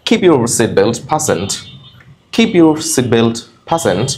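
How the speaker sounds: very slow, drawn-out cabin announcement; announcement read. Shown as a demonstration. Teacher demonstrating a seat belt announcement. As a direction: read it like the crew over the speaker. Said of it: "So please".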